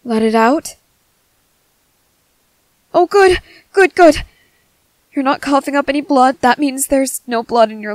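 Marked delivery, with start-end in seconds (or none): 2.9-4.2 higher, louder burst of relief; eager relief. Acted. Medic after patient breathes deeply, eager relief. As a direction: let the relief spill out in repeats.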